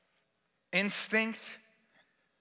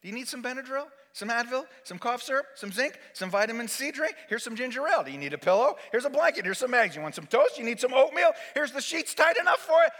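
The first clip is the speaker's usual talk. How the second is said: acted overbearing nurse, climbing higher; frantic fussing; 6 semitones higher, 3 dB louder than usual. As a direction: rattle off a relentless list of offers. Wife's escalating barrage of offers.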